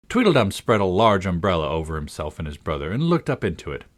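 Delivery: ordinary delivery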